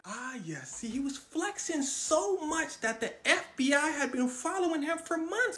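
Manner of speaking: ordinary talk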